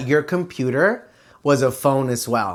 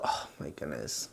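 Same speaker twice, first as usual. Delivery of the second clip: whispered aside; 19 dB quieter than usual. Real, not acted. Muttered aside to herself.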